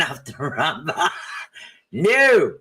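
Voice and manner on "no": firm no. Real, not acted. Scolding the pet.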